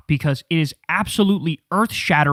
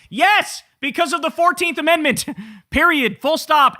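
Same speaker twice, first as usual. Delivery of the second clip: triumphant yes; 9 semitones higher than usual. Real, not acted. Answering Crowder's complaint, triumphant and much higher. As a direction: slam the point home with glee.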